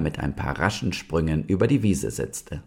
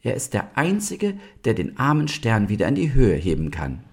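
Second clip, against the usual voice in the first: high-pitched, reasoning fawn; sensible young deer; 7 semitones higher than usual. A narrator's reading. Young deer talks down their fear, sensible. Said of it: "sagte die vernünftige Ulla".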